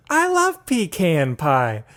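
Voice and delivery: slow, drawn-out mock enthusiasm; quoted sitcom dad. Retelling the dad's dinner line, slow hammed-up delivery. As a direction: stretch out love and pee-CAN pie. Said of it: "And Lizzie's dad says".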